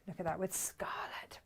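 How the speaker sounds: soft admiring whisper. Real, not acted. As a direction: breathe it out in a whisper.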